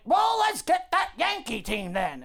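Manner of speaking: high, slow demanding holler; tyrant boss. Acted. Boss ordering Clintack to find people, demanding. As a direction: lay down orders with hammed-up bluster.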